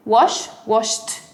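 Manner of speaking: "washed" as wash-t, one syllable; bare "Wash", then "washed" with t added; final T sound. Shown as a demonstration. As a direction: end "washed" on a crisp voiceless t. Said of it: "lo pronuncio con T".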